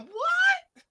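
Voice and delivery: high-pitched, shocked squawk; scandalized high "what"; incredulous outburst. Acted. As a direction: cry out in mock disbelief, voice leaping up. Incredulous reaction to a confessed excuse.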